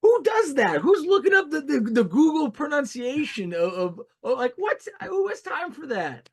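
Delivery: incredulous outburst. Real, not acted. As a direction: squawk in exasperated disbelief at a friend. Mock outrage at friend's googling.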